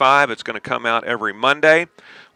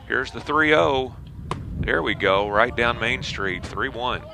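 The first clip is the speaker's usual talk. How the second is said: play-by-play; 3 semitones higher, 4 dB quieter than usual. Acted. Live baseball play-by-play.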